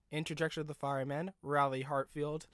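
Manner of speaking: plain reading voice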